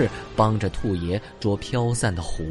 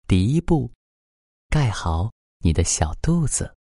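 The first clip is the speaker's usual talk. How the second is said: bedtime ritual guide; 5 dB louder than usual. Acted. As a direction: gently lead a child to sleep. (哄睡的温柔引导语气)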